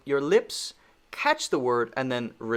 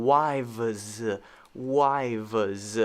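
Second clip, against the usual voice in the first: "wives" stretched slowly into two syllables; slowed wrong way; 2 semitones lower than usual. Shown as a demonstration. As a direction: drag out "wives, wives" as "wai-vez". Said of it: "I'm not saying".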